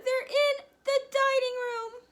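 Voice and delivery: very high, loud frightened cry; terrified shriek from the mother; panicked hiss. Acted. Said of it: "hissed the mother".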